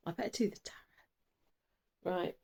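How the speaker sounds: whispered aside. Real, not acted. Hushed aside to herself.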